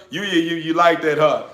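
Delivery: ordinary talk, nothing marked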